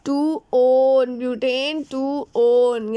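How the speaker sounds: टू ओन drawn out very slowly; slow dictation. Real, not acted. Teacher naming the four-carbon compound, slow dictation. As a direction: stretch each part of butane टू ओन.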